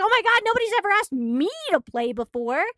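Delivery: high-pitched imitation of an overjoyed friend; thrilled retold squeal of delight; so excited. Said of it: "she gets so excited".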